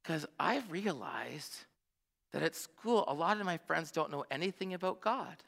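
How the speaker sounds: daughter's sincere words. Retold or imitated. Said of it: "She says".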